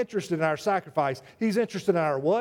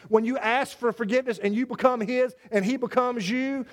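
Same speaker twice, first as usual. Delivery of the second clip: ordinary talk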